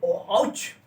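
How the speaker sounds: high sudden yelp; ouch exclamation. Shown as a demonstration. Teacher demos an exclamation before Hindi. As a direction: react to a sudden pain. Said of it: "आप ये बोल सकते हैं".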